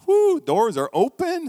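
joyful whoo, pitch high and loud, slowly; excited whoo. Pretend. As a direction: burst out in delight as the gates open. Imagining entering as God's child.

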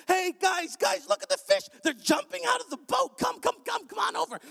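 high, eager shout to friends; excited calling out. Retold or imitated. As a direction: call the partners over, thrilled. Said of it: "he begins to beckon his partners".

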